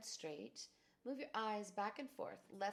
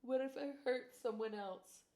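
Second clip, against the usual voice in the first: anxious cry; 5 semitones higher, 5 dB louder than usual. Acted. Repeated fear phrase, first take.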